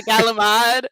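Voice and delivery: Galamad with a hard g; laughing Galamad. Shown as a demonstration. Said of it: "calling this species the".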